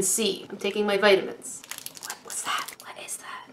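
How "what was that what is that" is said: unvoiced whispered question. Real, not acted. Whispering to herself over a mystery snack. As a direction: hush it like a secret.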